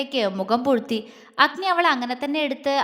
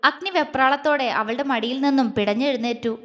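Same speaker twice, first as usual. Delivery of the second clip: ordinary delivery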